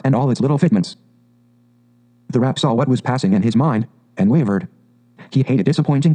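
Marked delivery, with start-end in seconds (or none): none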